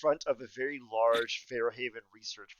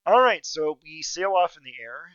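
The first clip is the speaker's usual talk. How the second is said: ordinary talk, nothing marked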